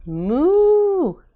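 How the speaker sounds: high, loud playful cow moo; child mooing. A narrator's reading. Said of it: "Are you a cow?".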